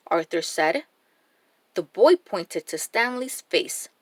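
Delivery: ordinary delivery, nothing marked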